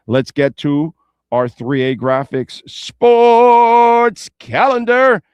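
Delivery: big, slow, booming announcer voice; announcer intro. Acted. Host kicking off the sports calendar segment for listeners, booming announcer call. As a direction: stretch it out like a stadium announcer.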